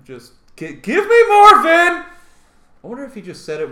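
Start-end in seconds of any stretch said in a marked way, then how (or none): 0.6-1.9 slow, high, full-volume mock scream; hammed-up booth yell; screaming director. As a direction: scream it at the actor. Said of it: "they're just screaming at him".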